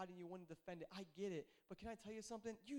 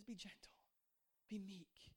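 whispered, much softer; 8 dB quieter, slower than usual. Acted. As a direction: breathe the words gently. Quoting Jesus softly.

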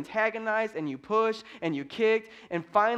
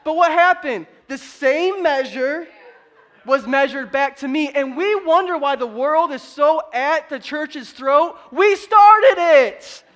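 loud, high, forceful preaching; impassioned sermon shouted to the crowd; 7 semitones higher, 10 dB louder than usual. Real, not acted. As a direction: drive the point home at full voice.

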